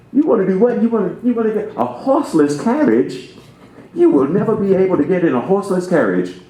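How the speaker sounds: mock the doubter who can't believe it; incredulous skeptic. Minister mimics an old skeptic scoffing at cars. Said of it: "someone yonder hinder said".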